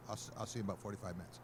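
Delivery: rushed, very fast reassurance; husband hastily brushing off his worried wife; hurried. Retold or imitated. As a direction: get her off the line quickly. Hurrying off phone with wife.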